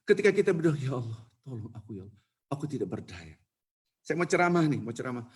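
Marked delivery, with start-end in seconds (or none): 0.8-3.4 much softer, lower, helpless plea; pitiful pleading. Shown as a demonstration. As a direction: beg God quietly as if powerless. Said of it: "Doa itu harus melas".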